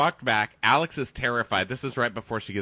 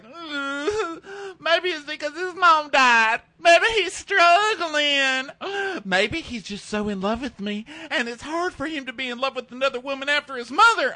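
mock crying; 6 dB louder than usual. Imitating JoJo crying about Chad on a log. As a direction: wail it like a melodramatic bachelorette. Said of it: "And then she starts crying".

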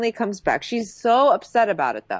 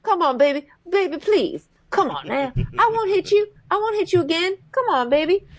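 put-on higher, wheedling pleas to baby; mock abuser pleading; 7 semitones higher than usual. Imitating [name] begging [name] like [name]. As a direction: beg her back like a remorseful abuser. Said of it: "full on, like, [name]".

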